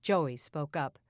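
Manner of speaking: usual speaking voice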